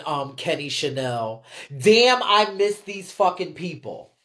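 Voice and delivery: ache for the ones who are gone; wistful longing. Real, not acted. Streamer missing ballroom legends, wistful and low.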